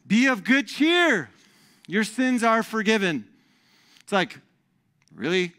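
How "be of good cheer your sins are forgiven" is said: high, drawn-out cheerful pronouncement; big-hearted announcement; hearty proclamation. Acted. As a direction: proclaim good news, voice lifted. Quotes Jesus, theatrically raised.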